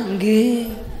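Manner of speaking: drawn-out meek yes; obedient wife. Acted. Solo wife answering husband, meek falling reply. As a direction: stretch it out submissively.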